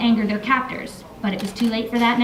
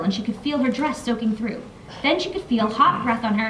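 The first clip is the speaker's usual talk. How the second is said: ordinary delivery, nothing marked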